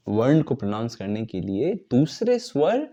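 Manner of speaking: normal talking voice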